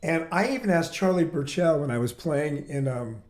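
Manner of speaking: ordinary talk, nothing marked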